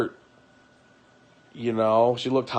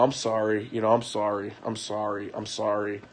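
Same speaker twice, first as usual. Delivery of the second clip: low, fading sorry; subdued, defeated apology; guilty apology; 3 semitones lower, 6 dB quieter than usual. Retold or imitated. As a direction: apologize softly, sinking with each repeat. Retold guilty apology after feeling bad.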